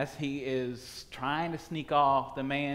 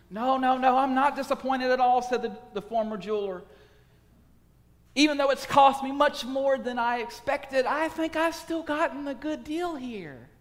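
higher and louder, pleading over the pearl; 11 semitones higher, 5 dB louder than usual. A narrator's reading. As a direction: give away everything to get it.

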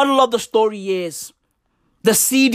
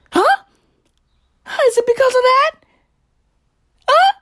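high squeaky mocking question; squeaky taunt; 4 dB louder, faster than usual. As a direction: squeak it out teasingly.

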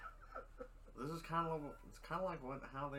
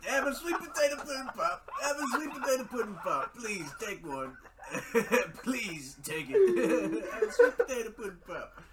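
higher, laughing mock Cosby pitch; Bill Cosby impression; 6 semitones higher, 5 dB louder than usual. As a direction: ham up a Cosby-style sales pitch through giggles. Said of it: "back to the Cosby family house".

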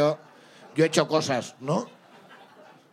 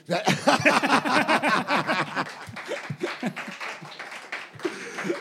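long laugh; 4 semitones higher than usual. Real, not acted. Laughs at host's joke.

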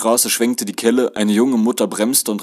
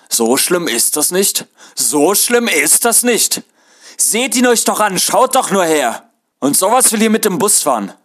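bellowing; 10 semitones higher, 6 dB louder than usual. A narrator's reading. Conductor shows the passenger up before the bus, bellowing. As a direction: yell in mocking outrage, stretching the words. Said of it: "Die Schaffnerin begann rumzubrüllen".

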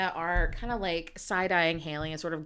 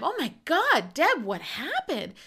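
alarmed; 8 semitones higher, 6 dB louder than usual. Retold or imitated. Karen finds Deb upset.

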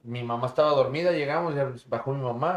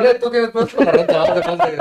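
loud high mocking voice; mock debt collector; 4 semitones higher, 11 dB louder than usual. Joking the ghost came for the rent, mock collector. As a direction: play a pushy collector for laughs.

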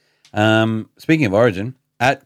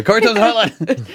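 high excited shout, laughing; 12 semitones higher, 6 dB louder than usual. Retold or imitated. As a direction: yell it like a fan in the stands. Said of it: "I was saying it so loudly".

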